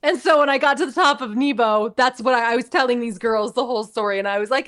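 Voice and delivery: usual speaking voice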